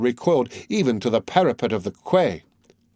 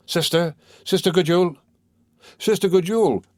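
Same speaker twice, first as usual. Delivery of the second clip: calling out; 6 semitones higher than usual. A narrator's reading. Women calling through the air hole to the silent recluse, anxiously calling out. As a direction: call to her, higher on each repeat.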